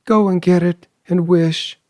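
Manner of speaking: high, urgent excited cry; quivering excitement. A narrator's reading. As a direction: urge him on, trembling with hope. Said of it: "cried his wife, quivering with excitement".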